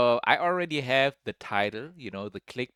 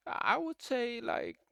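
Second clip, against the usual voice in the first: imitating a hesitant, half-hearted "I forgive her" in a higher, softer voice; mock unconvincing; 11 semitones higher, 5 dB quieter than usual. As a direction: mimic a reluctant forgiveness, dragging it out. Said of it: "That didn't sound too convincing".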